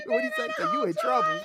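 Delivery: very high voice; mock a scolding wife; mimicking the wife. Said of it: "get yelled at by our wives".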